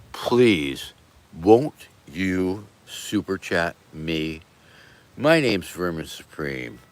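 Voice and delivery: slow, low, pleading charity-appeal voice; hammed-up starving-child telethon plea; charity ad parody. Streamer parodies a charity appeal to beg for super chats, slow and low. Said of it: "maybe I should start begging".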